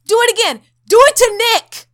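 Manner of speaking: angry taunting yell at ghosts; mock yelling. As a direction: dare the ghosts at full volume. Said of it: "so he's just yelling at them".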